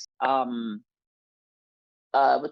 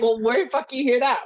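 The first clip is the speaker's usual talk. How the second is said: higher-pitched mock indignation; miffed dad; 8 semitones higher, 3 dB louder than usual. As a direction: retort like an interrupted, put-out dad. Dad's miffed challenge, retold.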